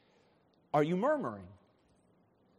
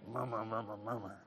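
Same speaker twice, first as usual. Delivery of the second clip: low, very soft repeated grumble; mock muttering; 9 semitones lower, 12 dB quieter, faster than usual. People frustrate you and nobody hears, mock muttering. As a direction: mumble it under your breath in imitation. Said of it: "you mutter to yourself".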